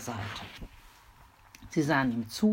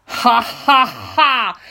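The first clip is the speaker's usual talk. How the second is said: booming, far louder villain laugh; terrible laugh; 8 semitones higher, 12 dB louder, much slower than usual. Acted. Robber laughing horribly at Mimi. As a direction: cackle even worse. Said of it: "lachte Fiolito".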